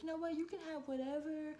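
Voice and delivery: manager imitated in a high voice; Moana. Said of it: "She was like".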